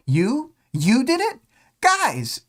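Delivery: rising shock, then calling out; shocked realization. Retold or imitated. Tattletale realizing Taylor did it.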